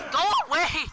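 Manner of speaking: scared, urgent shout; panicked shout. Real, not acted. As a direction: yell at the attacker to back off. Shouting at attacker.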